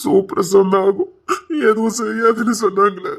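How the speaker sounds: slow, loud tearful complaint; crying. Acted. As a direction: wail the words out in worry. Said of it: "உட்கார்ந்து அழுதுகிட்டு இருக்க".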